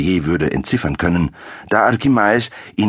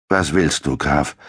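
ordinary delivery, nothing marked

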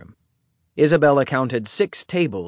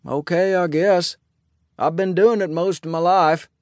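friendly sheriff; 6 semitones higher than usual. A narrator's reading. Sheriff invites the bride-to-be to Sunday supper, friendly. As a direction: play an easygoing lawman, pitched well up.

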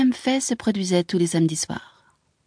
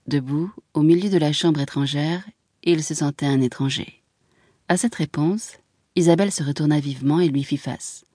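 ordinary delivery, nothing marked

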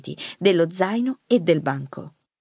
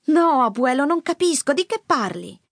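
bewildered granddaughter; 8 semitones higher than usual. A narrator's reading. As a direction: protest in confusion to your grandfather. Granddaughter bewildered by his warning. (nipote sconcertata, voce acuta)

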